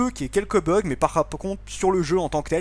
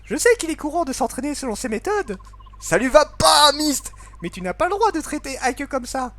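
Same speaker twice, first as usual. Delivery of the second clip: put-on high girl voice; 9 semitones higher than usual. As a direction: plead with papa in a girlish voice. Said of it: "faire des voix un petit peu particulières".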